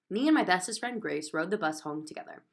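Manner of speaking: ordinary delivery, nothing marked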